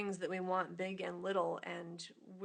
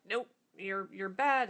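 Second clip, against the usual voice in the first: a sharp, louder scold on bad; scolding critic; 9 dB louder than usual. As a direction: play the inner critic telling you off. Voicing the inner critic.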